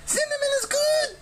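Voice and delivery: very high bird-woman declaring; high harpy verdict; harpy pronouncing. A narrator's reading. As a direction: announce it like a pleased harpy. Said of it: "she pronounced".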